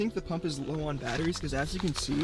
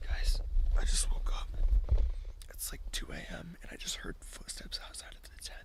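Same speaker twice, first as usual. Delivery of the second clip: whispering; 3 semitones higher than usual. Real, not acted. Woken by footsteps at 2 AM.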